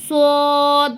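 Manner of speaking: সদ thick and hard, lips rounded; heavy Arabic sad. Shown as a demonstration. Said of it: "একটু গোল করে আপনারা সদটা উচ্চারণ করবেন".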